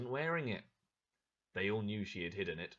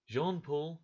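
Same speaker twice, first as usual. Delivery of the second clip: ordinary delivery, nothing marked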